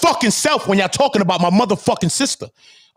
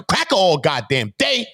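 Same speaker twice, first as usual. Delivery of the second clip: ordinary talk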